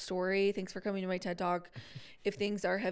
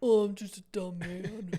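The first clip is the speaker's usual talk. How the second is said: badly faked dopey man; dumb man voice. Shown as a demonstration. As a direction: put on a dim-witted guy, hammed up. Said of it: "when I want to do a man voice".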